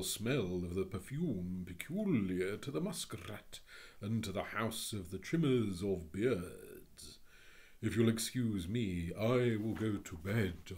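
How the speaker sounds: haughty, long-suffering declamation; pompous creature complaining wearily; grand Phoenix. Acted.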